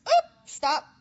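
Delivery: sudden high, loud yelp of alarm; startled stop. Pretend. Speaker pretending to catch herself, startled high yelp. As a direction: jump back with a mock startled cry. Mock pulling back from danger.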